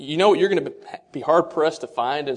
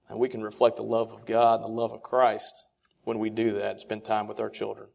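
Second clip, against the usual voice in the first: ordinary talk, nothing marked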